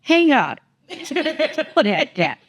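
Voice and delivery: high-pitched firm scolding, half laughing; no-nonsense mom. Retold or imitated. Mom ordering her daughter off a FaceTime call, high exasperated scold. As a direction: tell her to hang up, exasperated but amused.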